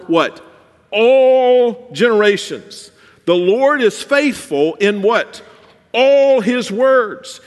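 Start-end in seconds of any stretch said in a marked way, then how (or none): 0.9-2.9 "All" drawn out, loud and high, slow; stress on all. Acted. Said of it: "I just wanna emphasize a word". 5.9-7.4 "All" held long, loud and high, slow; stress on all. Acted. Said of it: "I just wanna emphasize a word".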